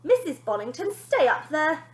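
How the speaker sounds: high, loud nanny command; nanny ordering mother; firm order. Acted. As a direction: order her to stay. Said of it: "ordered Wonder Nanny".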